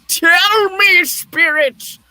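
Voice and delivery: high, loud desperate plea; frantic demand. Acted. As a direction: demand an answer in anguish. Scrooge begs the silent spirit.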